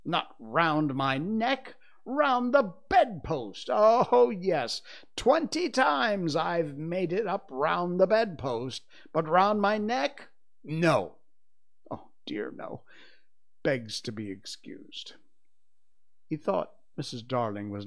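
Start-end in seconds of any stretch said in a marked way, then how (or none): 0.0-11.2 loud, high, drawn-out sarcasm; slow, scathing mock amazement; dangerously sarcastic. A narrator's reading. Father rants to mother about his tie, sarcastic. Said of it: "He became dangerously sarcastic". 11.9-15.1 suddenly hushed sarcasm; dangerously sarcastic. A narrator's reading. Father mocks the tie's refusal, quietly sarcastic. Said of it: "He became dangerously sarcastic".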